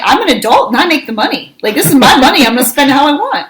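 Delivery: worked-up retold parental comeback; exasperated mom retort. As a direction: lay down the law, indignantly. Mom arguing back at kids in retelling.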